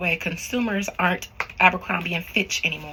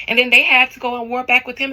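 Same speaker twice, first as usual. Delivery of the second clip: ordinary delivery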